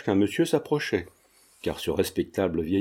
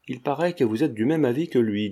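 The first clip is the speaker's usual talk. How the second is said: usual speaking voice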